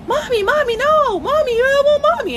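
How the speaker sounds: put-on very high small-child wail; imitating a child calling for mommy; toddler crying for mommy. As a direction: cry out like a toddler. Voicing toddler wanting her mother.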